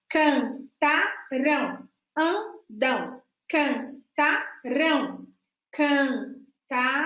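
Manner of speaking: "Cantarão" repeated very slowly, stress on "rão"; slow drilling. Shown as a demonstration. Teacher drills the future ending for children to copy. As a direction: drill "cantarão", stretching each syllable. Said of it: "faça a correção".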